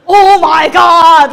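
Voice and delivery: very loud, slow, hammed-up wail; hammed-up loud dismay at the silent chat; mock horror. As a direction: stretch it out in mock despair. No reply to I love you.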